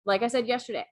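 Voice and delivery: ordinary talk, nothing marked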